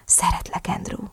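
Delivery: very soft, whispered confession. A narrator's reading. Narrator confessing love to partner, whisper. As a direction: breathe the words out gently.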